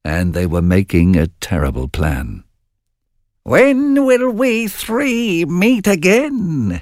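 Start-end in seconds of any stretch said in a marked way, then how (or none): 3.4-6.8 very high, slow cackling witch. Acted. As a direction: drag it out like an old hag. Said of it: "said one witch".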